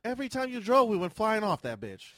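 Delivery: high, loud, worked-up protest; loud teasing accusation. Real, not acted. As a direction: raise your voice in playful outrage. Mock argument over jet ski driving.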